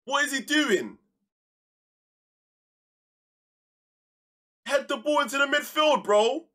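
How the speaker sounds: high, loud exasperated yelling; angry shout. Real, not acted. Gamer shouting at his defender to head it forward, angry shout. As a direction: yell the instruction in frustration.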